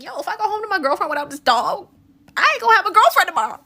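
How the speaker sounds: high, loud comic panic, speeding up at the end; mock panic. Man who lost his girlfriend's dog pleading with strangers, mock panic. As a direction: imitate a man panicking, pitch climbing into a near-shout. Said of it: "He is now in a full-blown panic".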